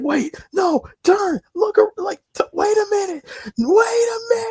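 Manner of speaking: very high, crying plea; panicked cry. Retold or imitated. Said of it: "he's like".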